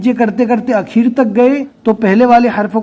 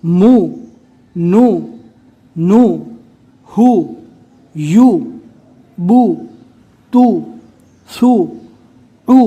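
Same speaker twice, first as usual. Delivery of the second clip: syllable drill; slower than usual. Shown as a demonstration. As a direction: chant each syllable on its own, clearly.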